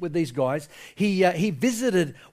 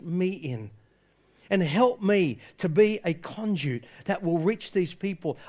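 quiet, earnest plea to God; prayer; 5 dB quieter than usual. Shown as a demonstration. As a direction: pray softly, building gradually from a hush. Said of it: "Get into His presence and ask Him".

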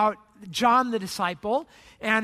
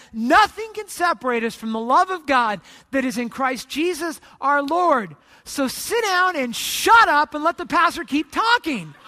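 loud, emphatic big-honcho declaration; retold yelling; 3 semitones higher, 5 dB louder than usual. Big honcho silencing arguing church leaders, loud retold yelling. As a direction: raise the voice and pitch, building to a firm command. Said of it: "in a really loud voice, he starts yelling".

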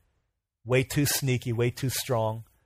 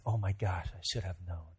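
low, soft, crushed; quietly devastated realization; dismayed realization; 6 semitones lower, 10 dB quieter than usual. Acted. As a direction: say it to yourself, defeated. Girl realizing betrayal.

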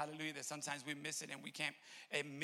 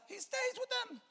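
very high, piercing preacher's cry; sudden soaring exclamation; exclaimed cry; 4 dB louder than usual. Real, not acted. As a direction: shoot the answer way up high in triumph. Preacher's exclaimed answer, then repeated softly.

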